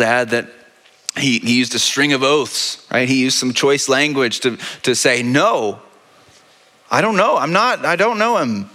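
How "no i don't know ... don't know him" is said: heated denial. Acted. Peter cursing denial to accusers.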